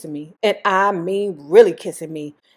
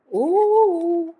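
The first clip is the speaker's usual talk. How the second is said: teasing ooh; 10 semitones higher than usual. Real, not acted. Reacts to marriage proposal.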